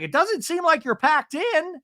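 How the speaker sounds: high imitated remark; mock Shannon. Host mimicking Shannon's comment on the rooms, high-pitched. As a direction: say it in a high mocking impression. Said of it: "And Shannon's like".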